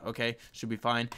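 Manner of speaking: usual speaking voice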